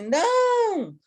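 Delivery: high, loud defiant no; a lone loud no shouted back. Retold or imitated. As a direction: yell no against the whole room. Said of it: "E eu gritava assim".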